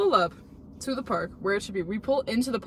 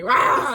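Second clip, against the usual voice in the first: creature growl; 8 dB louder than usual. Retold or imitated. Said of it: "he's like".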